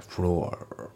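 "フロア" ending in a curled, ringing R; American-style floor with a strong final r. Shown as a demonstration. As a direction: curl the tongue on the end of フロア. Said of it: "巻くような感じで".

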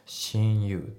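"seeing you" slowly, with the final G left out; dropped G. Shown as a demonstration. Teacher showing natural speech, "seeing you" without the G. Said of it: "seeingのGがね、ほぼ抜けてますね".

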